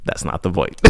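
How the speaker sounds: whispered aside; whispering. Real, not acted. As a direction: breathe it as a quick aside.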